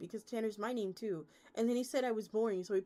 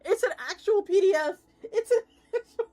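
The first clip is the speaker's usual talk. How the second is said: much higher and louder, cracking into laughter; shocked laughing shout; 11 semitones higher, 13 dB louder than usual. Real, not acted. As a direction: yelp in delighted disbelief. Discovering the rulebook is PDF.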